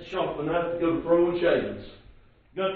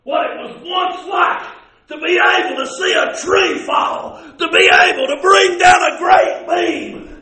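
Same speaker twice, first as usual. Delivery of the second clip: high, loud, impassioned pulpit cry; ringing high sermon delivery; full-force preaching; 8 semitones higher, 6 dB louder than usual. Real, not acted. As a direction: preach it out at a shout, drawing out the key words.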